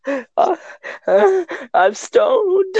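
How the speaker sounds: high, slow, tearful sob of a confession; crying confession. Retold or imitated. Teen confessing to mom, high and slow, crying. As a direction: blubber it out through tears. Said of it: "And I just start crying".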